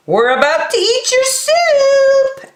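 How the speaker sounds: put-on very high child calling out; small girl announcing lunch; squeaky cartoon kid. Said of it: "added Dakota".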